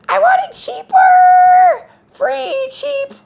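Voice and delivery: very high, slow, shouted grabby creature voice; free-po cheapo creature. Retold or imitated. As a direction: cry out like a greedy little thing reaching up from the ground. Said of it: "they talk like this".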